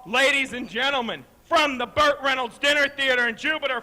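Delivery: normal talking voice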